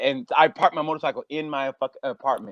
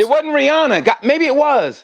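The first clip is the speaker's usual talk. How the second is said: louder and higher, exasperated; 9 semitones higher, 7 dB louder than usual. Real, not acted. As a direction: throw up your hands, louder. Frustrated searching.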